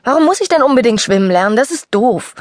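put-on girl pleading with the boy to let her go. A narrator's reading. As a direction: beg and protest in a rush. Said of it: "flehte sie".

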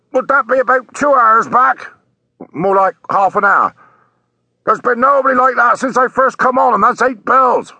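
high-pitched, emphatic sailor voice; ship's sailor. Acted. Sailor answering a policeman from the deck, emphatic. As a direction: call down firmly from up at the rail.

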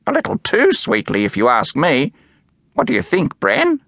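high squawky bird voice; wry little bird comment; parrot voice. A narrator's reading. Doris the parrot speaks.

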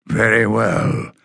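slow rasping guard. A narrator's reading. Guard speaks.

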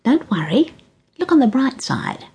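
a hint of granny cheering her up; mild kindly granny voice; lightly voiced reassuring granny.

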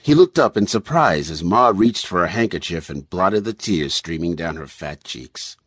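plain reading voice

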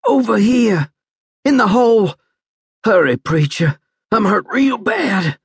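high, strained cry for help; pained urgent plea. Acted. Wounded soldier in a hole calls the medic, pained and urgent. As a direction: plead high, drawing out the hurt.

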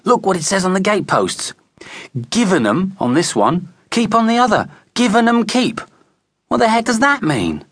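put-on high-pitched, piping child voice; high, eager kid calling friends over; excited child's cry. A narrator's reading. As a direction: pipe up high, full of curiosity. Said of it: "cried Titch".

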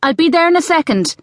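mother's high-pitched call; calling back. A narrator's reading. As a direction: call it high towards the garden. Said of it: "Ali called back".

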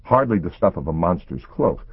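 ordinary delivery

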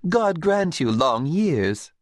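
a friendly rider's sing-song greeting; light musical tenor. A narrator's reading. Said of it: "his voice a light, musical tenor".

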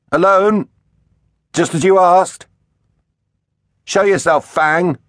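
loud, high-pitched challenge; calling out. A narrator's reading. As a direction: call to a hidden enemy. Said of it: "he called".

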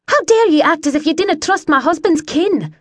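high-pitched, offended lady's outburst; indignant sputter. A narrator's reading. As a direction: sputter in outrage, voice pitched right up. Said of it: "Bella sputtered".

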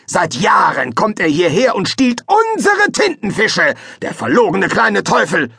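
high, loud ranting; angry rant. Acted. Fester rants to his son about the rival, high and loud. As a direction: rail against the thieving rival.

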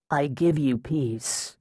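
bestow calm on him slowly; gentle blessing. Acted.

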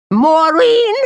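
Maureen! yelled high and loud, stress on reen; yelled name, stress on second syllable. A narrator's reading. Gran calling her granddaughter out of the shed, loud yell. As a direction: bellow Maureen with the weight on reen. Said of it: "she yelled, heavy emphasis on the second syllable".

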